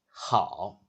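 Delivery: HAO dipping low, then lifting; third tone. Shown as a demonstration. Teacher putting the syllable together, HAO in a low third tone. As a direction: let HAO sink into a low valley. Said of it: "and the third tone".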